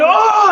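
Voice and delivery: a short high yelp of shock; startled yelp. Retold or imitated. As the boat explodes, a high startled oh. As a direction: yelp as the flames hit. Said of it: "and I screamed".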